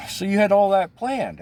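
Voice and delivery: high, loud, disbelieving question; incredulous friend. Retold or imitated. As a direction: ask it in shocked disbelief. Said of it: "She's like".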